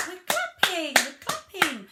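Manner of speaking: high, loud sing-song chant; demonstrated mummy-to-child voice. Shown as a demonstration. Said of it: "You could say".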